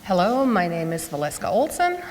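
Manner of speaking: ordinary talk, nothing marked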